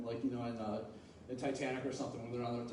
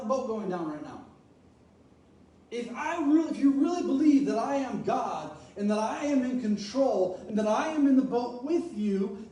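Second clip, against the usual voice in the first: louder, driving sermon voice; fervent preaching; 9 semitones higher, 8 dB louder than usual. Acted. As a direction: preach it with conviction. Preaching Jesus' rebuke.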